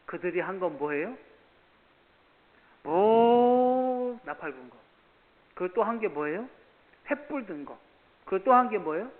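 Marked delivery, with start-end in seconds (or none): 2.8-4.8 high, loud and very slow; drawn-out preaching. Acted. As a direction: drag the words out for effect. (느리게 늘여 외치듯)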